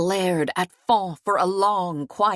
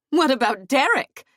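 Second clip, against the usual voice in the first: high, loud challenge; sharp demand; 6 semitones higher, 5 dB louder than usual. Acted. Fawn challenges Hyatt.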